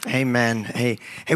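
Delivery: ordinary talk, nothing marked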